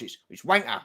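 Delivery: low, offhand Cockney accent. Shown as a demonstration. Host corrects guest's too-posh word, Cockney accent. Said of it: "that sounds too posh".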